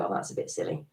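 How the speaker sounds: a hushed aside of doubt giving way; whispered realisation. Retold or imitated. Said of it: "hear your inner voice suddenly go".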